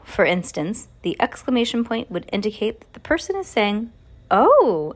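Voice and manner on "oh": bright, loud excited "Oh"; "Oh" thrown out loud and delighted. Shown as a demonstration. Narrator demonstrating an excited reaction, loud and bright. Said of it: "in an excited way".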